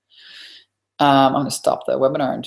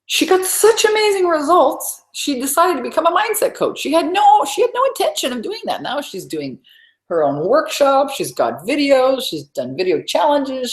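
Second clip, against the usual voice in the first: excited; 11 semitones higher than usual. Real, not acted. Coach gushing about a client's amazing results, excited. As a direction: rave about her success.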